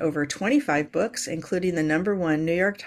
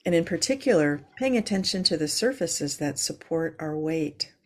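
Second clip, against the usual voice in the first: calm grounding exercise. Acted. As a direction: ease them into their breath. Leads a yoga breathing moment.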